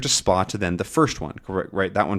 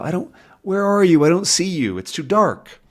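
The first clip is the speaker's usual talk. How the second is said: searching in vain; 8 semitones higher than usual. Acted. Acting out searching in dark.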